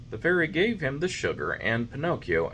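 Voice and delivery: ordinary delivery, nothing marked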